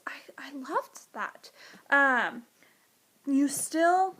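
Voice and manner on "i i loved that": whispered. Real, not acted. Whispered aside about loving Winter.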